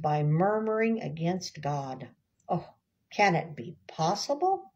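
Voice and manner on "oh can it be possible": usual speaking voice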